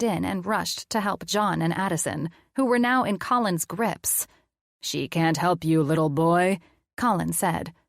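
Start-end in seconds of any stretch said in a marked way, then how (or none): 4.8-6.6 mad scientist. Acted. Colin playing mad scientist to the kids, gloating. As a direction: tease like a hammed-up cartoon villain.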